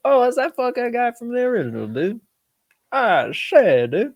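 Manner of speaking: high-pitched fanboy outburst, retold; excited recognition. Movie fan spotting the guy from the original, high excited reenactment.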